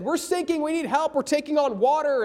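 lightly voiced frantic sailor; mock panic. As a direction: cry for help from a sinking ship. Sailors calling for help.

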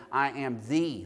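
"the" said as a long "thee"; stress on THE. Shown as a demonstration. Preacher emphasizing the next word of the verse, long stressed "the". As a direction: stop on a drawn-out "the", rhyming with "me". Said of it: "each word one at a time".

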